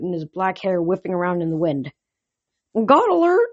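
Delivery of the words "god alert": high, loud warning yell; yelled alarm. A narrator's reading. Horse warning his rider of a god, yelling. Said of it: "Blackjack yelled".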